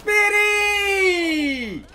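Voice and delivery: drawn-out high calling yell; long calling shout. Real, not acted. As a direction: hold the call out long and high. Calling out in-game, long held.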